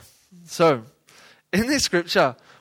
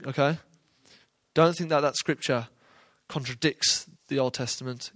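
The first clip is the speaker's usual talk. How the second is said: usual speaking voice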